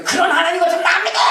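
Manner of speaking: high, fast angry outburst; mock angry yell. Angry believer yelling at God, mock shouted rant. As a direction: play the believer screaming at God. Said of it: "소리를 바락부르면서".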